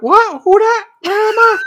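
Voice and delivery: high, loud French-ish confused voice; Ludovic impression. Coworker Ludovic playing dumb when caught, high confused squeak. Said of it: "when he talked normally".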